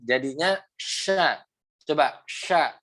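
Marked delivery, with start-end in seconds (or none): 0.0-1.4 "sha" very slow with the mid tongue raised; slow correct sha. Shown as a demonstration. Teacher showing Baihaqi the correct sha, slow. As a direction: model the right sha slowly. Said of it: "tengah lidah yang naik".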